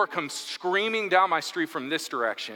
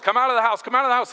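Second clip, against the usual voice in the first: high, louder mock police shout; retold bullhorn yell; 7 semitones higher, 4 dB louder, much faster than usual. Cops calling into a house through bullhorns, mock yell. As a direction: imitate cops yelling an order. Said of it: "these cops are yelling at bullhorns".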